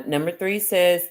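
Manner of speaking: normal talking voice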